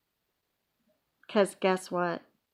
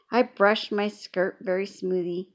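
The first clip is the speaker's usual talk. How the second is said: ordinary delivery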